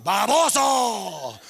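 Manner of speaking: "Baboso" loud, high and stretched out; cursing grandma. Shown as a demonstration. Grandma cursing at you, long loud "Baboso". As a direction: hurl "Baboso" like a long curse. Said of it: "sounds like grandma's cursing at you".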